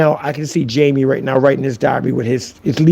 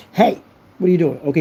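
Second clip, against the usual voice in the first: normal talking voice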